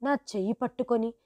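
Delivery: ordinary delivery, nothing marked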